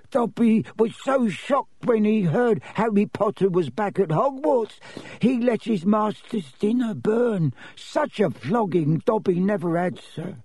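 high, agitated little elf voice; high-voiced servant recounting his woes; Dobby the elf, distressed. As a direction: lament in a high-pitched elf voice.

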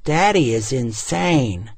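lightly voiced young girl; a hint of a child declaring it; little girl. Daughter comments on her father, lightly voiced child. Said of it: "Kelsey said".

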